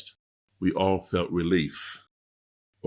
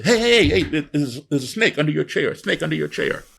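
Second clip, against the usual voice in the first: fake alarm; 6 semitones higher, 4 dB louder than usual. Teacher pranks kids with fake alarm.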